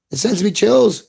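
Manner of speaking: ordinary talk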